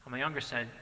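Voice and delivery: usual speaking voice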